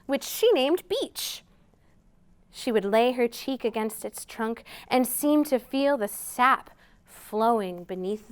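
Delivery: gentle picture-book read-aloud; storytime reading. A narrator's reading. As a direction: read it slowly to little listeners.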